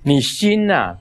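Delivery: ordinary delivery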